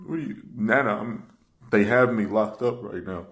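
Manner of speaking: usual speaking voice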